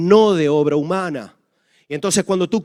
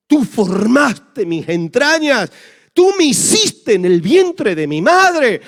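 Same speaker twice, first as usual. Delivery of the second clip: slower, higher and louder psalm proclamation; fervent psalm reading; 7 semitones higher, 6 dB louder, slower than usual. Acted. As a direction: cry out the verse with fervour. Psalm repeated, more fervent. (proclamación ferviente del salmo)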